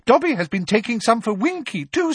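very high, cheerful elf voice; a bright little squeak of denial; happy elf squeak. Acted. Dobby answering Harry, high and happy.

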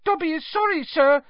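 high, squeaky anxious elf voice; anxious squeak. A narrator's reading. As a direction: squeak it out nervously like a house-elf. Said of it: "squeaked Dobby anxiously".

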